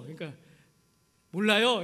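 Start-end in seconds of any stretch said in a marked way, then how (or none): 1.3-1.8 louder, blurted I-don't-know; blurted answer. Retold or imitated. New pastor answering his old friend, blurted loudly. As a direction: toss it off loudly. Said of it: "이러면서".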